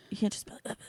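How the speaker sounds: unvoiced mock whisper; teasing whisper imitation. Shown as a demonstration. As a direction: whisper it to tease. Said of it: "whisper yell or something".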